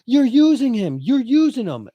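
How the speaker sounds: put-on heated girlfriend in a hotel argument; girlfriend arguing. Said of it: "and she's like".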